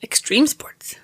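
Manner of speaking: unvoiced whisper, questioning. Real, not acted. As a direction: breathe it as a guess. Whispered guess.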